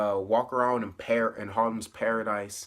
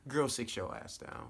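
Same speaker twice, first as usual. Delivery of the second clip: softer, dismissive put-down; sassy scold; 9 dB quieter than usual. Retold or imitated. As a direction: tell her off under your breath. Scolding a character.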